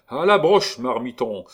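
loud barked orders; commanding shout. Acted. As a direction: command the room. Gondrequin commanding the painters.

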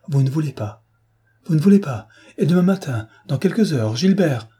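rising panic, each line harder; desperate accusation. A narrator's reading. Clarisse accuses Prasville, growing desperate. (Clarisse accuse Prasville, de plus en plus fort et désespérée)

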